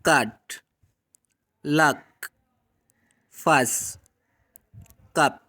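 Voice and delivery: कट, लक, कप with the short vowel of cup; teacher demo of the cup vowel; short u words. Shown as a demonstration. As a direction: say कप with a short open uh. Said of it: "For example".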